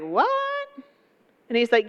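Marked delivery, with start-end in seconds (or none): 0.0-0.3 sharp rising surprised what; old priest caught off guard; startled what. Retold or imitated. Priest hearing the angel's news, startled rising question. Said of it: "And he was like".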